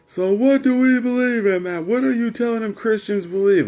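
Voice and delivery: high, loud imitation of Dad challenging; demanding dad. Dad challenging son in retold argument.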